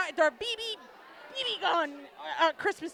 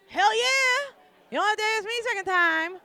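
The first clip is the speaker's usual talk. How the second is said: a much higher, louder gleeful yell; eager yes; 8 semitones higher, 3 dB louder than usual. Retold or imitated. She recalls being asked to induct her friend, much higher and louder. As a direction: jump at the offer with delight. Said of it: "I was like".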